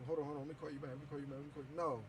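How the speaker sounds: low, very soft mimicry; mock brush-off. Friends cutting his call short, mimicked low and soft. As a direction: imitate a hushed, dropped-voice excuse on the phone. Mimics viewers putting him off.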